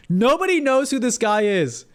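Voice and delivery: high, emphatic exclamation; amazed insistence. Real, not acted. Repeats the amazed claim.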